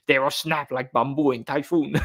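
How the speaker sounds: imitating a video game's Japanese voice while laughing; a hammed-up game-character Japanese accent; laughing through a cartoon Japanese accent; mock Japanese accent. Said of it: "You know the Japanese one?".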